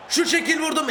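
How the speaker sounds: high shouted burst; excited shot call. Real, not acted. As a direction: shout it as you strike the ball. Takes a shot on goal. (şut çekerken heyecanla bağırarak)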